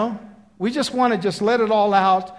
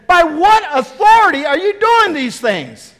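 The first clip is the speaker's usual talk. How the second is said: loud, slow, high accusing question; booming challenge from the chief priests; challenging demand; 9 semitones higher, 8 dB louder than usual. Acted.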